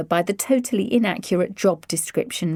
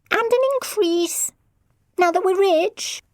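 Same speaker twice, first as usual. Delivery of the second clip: put-on cheeky teen; 12 semitones higher than usual. A narrator's reading. Teen cheekily demands more.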